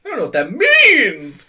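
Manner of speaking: mock outburst. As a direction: blurt it out high and loud.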